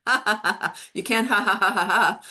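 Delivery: loud, hearty ha ha ha laughter demo. Shown as a demonstration. Teacher shows the class how laughter forces you to exhale, hearty laughing. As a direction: laugh out fully from the belly to show exhaling. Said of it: "'cause if you're laughing".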